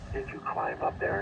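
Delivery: ordinary talk, nothing marked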